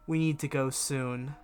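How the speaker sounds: ordinary talk, nothing marked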